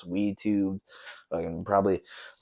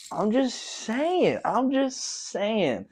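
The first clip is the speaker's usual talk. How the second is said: playful sing-song; 12 semitones higher, much slower than usual. Pretend. Repeated, teasing.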